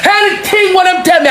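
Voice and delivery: ordinary delivery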